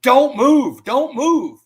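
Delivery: high, loud barked order twice; swat cop yelling. Retold or imitated. Swat cop with rifle in his eye yelling orders, loud and high. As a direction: bark it like the cop over him. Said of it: "he's screaming at the top of his lungs".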